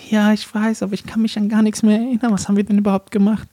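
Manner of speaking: high, put-on female phone voice; lady's voice. Man fishing for details from the other guy, lady's voice. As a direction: pose as a woman, coaxing out the story. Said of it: "mit dieser Damenstimme so an".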